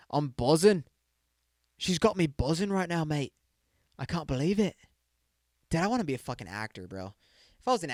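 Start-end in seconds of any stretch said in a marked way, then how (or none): 0.1-4.8 mock British Love Island accent. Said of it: "buzzing is a slang term that I found from Love Island".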